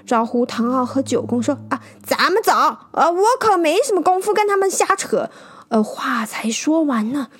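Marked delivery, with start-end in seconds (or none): 1.7-5.3 higher, fast, flustered outburst; exasperated. A narrator's reading. Traveller pushes through the crowd, exasperated and fast. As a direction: storm off in a huff. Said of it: "气急败坏地推开他们".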